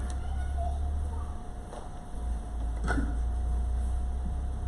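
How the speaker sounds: airless, high-pitched rueful laugh. Real, not acted. Preacher laughing at the disciples' later doubt, high-pitched. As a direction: let out a high, soundless ironic laugh. After "Son of God.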